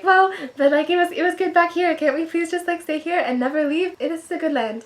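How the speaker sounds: put-on high, childish whine begging to stay; whiny pleading. Herself mock-begging God to stay back here, high whine. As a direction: pout it out like a kid who won't leave.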